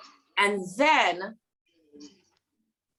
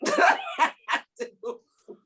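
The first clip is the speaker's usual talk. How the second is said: laughing; 8 semitones higher, 3 dB louder, slower than usual. Shown as a demonstration.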